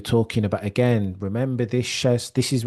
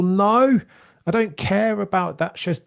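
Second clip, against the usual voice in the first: emphatic dismissal; 9 semitones higher than usual. Real, not acted. Host rejecting excuses made for a driver, emphatic dismissal. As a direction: dismiss the excuse outright, pitch leaping up.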